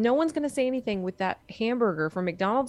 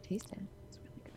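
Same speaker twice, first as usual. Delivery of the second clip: whispered offer; 18 dB quieter, faster than usual. Retold or imitated. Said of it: "I'm like".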